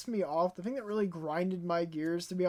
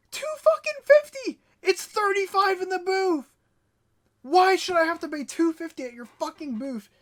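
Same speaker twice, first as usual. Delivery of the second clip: very high, loud incredulous yell; retold furious outburst at a rip-off; outraged disbelief; 9 semitones higher, 6 dB louder than usual. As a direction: shriek it back, scandalized. Retold outburst at reseller's price.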